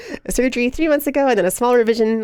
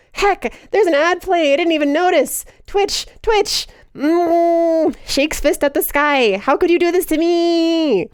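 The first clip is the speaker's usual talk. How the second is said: high, drawn-out theatrical dismay; mock outrage; 7 semitones higher, slower than usual. Streamer discovering an ad is playing, mock outrage. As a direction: wail in pretend betrayal.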